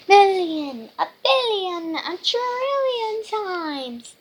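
high, loud storybook bluster; hammed-up pirate one-upmanship; escalating boast. A narrator's reading. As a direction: belt it out, stretching the final number.

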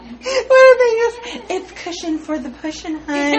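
cracking up mid-sentence; high, squeaky laughing. Real, not acted. Laughing through a joke.

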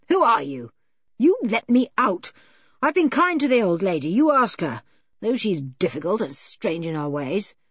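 emphatic protest that falls away; indignant, swinging character voice; agitated man pleading his case. Acted. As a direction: plead and bluster by turns.

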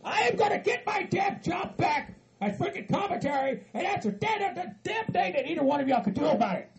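high, defiant shouted boasting. Acted. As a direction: declare the comeback in an angry yell. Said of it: "to raise your damn voice".

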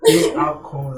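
usual speaking voice

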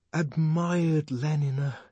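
high, slow lovestruck declamation; worshipful lover reciting verse; adoring recital. A narrator's reading. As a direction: draw it out reverently, pitched high.

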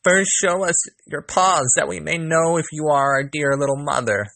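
put-on high little-kid voice; crying out. A narrator's reading. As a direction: demand it in a high young voice. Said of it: "The little kids cried".

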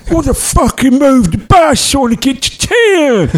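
put-on high, slow, furious old man's rant; angry yell. Grumpy passenger cursing at the driver for stopping, angry. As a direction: spit it out like an outraged passenger.

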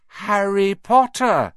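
slow, high, drawn-out chorus; sing-song it like twins in unison. A narrator's reading. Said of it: "chorused the twins".

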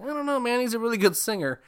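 very high imitated guy; mock squeaky objection; goofy character voice. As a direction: put on a silly high-pitched voice. Voices Beefcake Ronnie, very high.

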